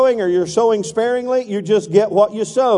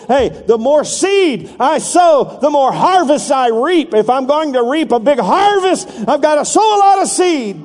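voicing the eager sower, rising to a shout; excited sower; 4 semitones higher than usual. Preacher voicing a sower throwing seed, excited shout.

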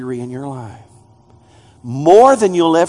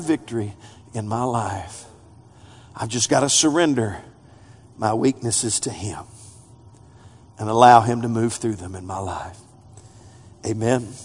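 low, soft, inward; quiet close; 8 semitones lower, 6 dB quieter than usual. Acted. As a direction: bring it down to a hush.

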